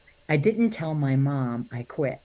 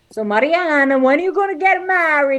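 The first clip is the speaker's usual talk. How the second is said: higher, louder nagging aunt; nosy Italian relative; 9 semitones higher, 8 dB louder than usual. Retold or imitated. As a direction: pester her like an old relative at dinner. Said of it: "they would always say".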